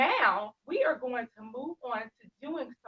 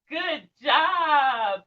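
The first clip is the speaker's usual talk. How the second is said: ordinary talk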